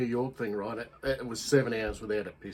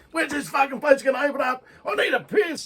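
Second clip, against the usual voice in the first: very high-pitched mock shout of a desperate Australian; agitated Aussie; 13 semitones higher, 4 dB louder than usual. Aussie stuck in Times Square needing a piss, agitated shout. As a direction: yell it over a huge crowd, urgent. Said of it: "getting agitated 'cause they needed a piss".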